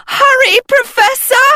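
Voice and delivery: very high, loud holler falling on Hurry. A narrator's reading. A girl yelling for her teacher to hurry, very high holler. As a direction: yell it at the top of your voice. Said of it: "Sophie hollered".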